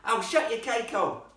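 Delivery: put-on loud, high, rough-accented shout; cheeky boy yelling; rough boy's yell. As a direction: shout her down like a rude lad. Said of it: "definitely the voice of a boy".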